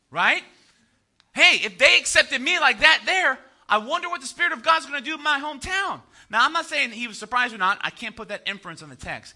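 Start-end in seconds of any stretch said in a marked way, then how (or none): 1.3-6.0 high, emphatic excitement; eager anticipation. Acted. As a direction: act out hopeful, wound-up expectation. Acting Jesus' high expectations.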